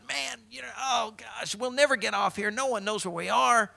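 high-pitched comic panic; freaking out. Acted. Stranded man to his companion on the island, freaking out. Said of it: "just freaking out, going".

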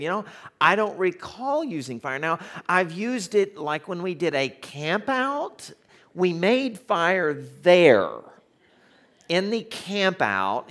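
high, stretched-out comic floundering; unsure. Retold or imitated. Pastor retells floundering over the fire question, high-pitched and unsure. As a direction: grope upward for an acceptable answer, drawing words out. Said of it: "not really sure which way to go".

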